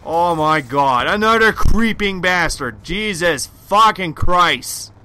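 raging gamer. Real, not acted.

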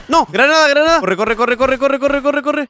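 panicked shouting; frantic yell while fleeing; being chased. Real, not acted. Grenade thrown, fleeing.

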